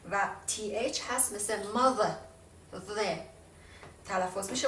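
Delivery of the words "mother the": "mother، the" with tongue between teeth; voiced th. Shown as a demonstration. Teacher demonstrating voiced th to viewers, tongue between teeth. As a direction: buzz the th in "mother، the". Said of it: "اونم زبون میاد بین دو تا دندون".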